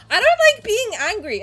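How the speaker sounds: loud, high-pitched frustration; mad. Real, not acted. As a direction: protest loudly in a high, angry voice. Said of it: "I'm so mad".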